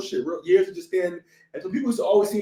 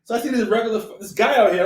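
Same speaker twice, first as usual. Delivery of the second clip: usual speaking voice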